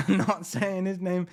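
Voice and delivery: laughing. Real, not acted. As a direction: chuckle while repeating it.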